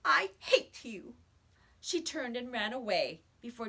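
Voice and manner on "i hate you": higher, louder, slow tearful shout; a girl shouting at the boy who broke her coin; hurt angry cry. A narrator's reading. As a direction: spit it out through hurt. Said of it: "Susan blurted out".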